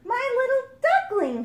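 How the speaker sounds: surprised mother duck greeting her duckling. Acted. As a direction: gasp with joy at your duckling.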